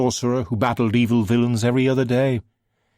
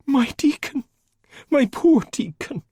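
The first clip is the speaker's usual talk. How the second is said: grieving; 4 dB louder than usual. A narrator's reading. Grieving neighbour.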